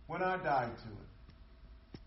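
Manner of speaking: slow, softened, weighty restatement; quiet reflective repeat. Shown as a demonstration. Preacher repeats the point to the congregation, slow and hushed. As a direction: let it sink in slowly and softly.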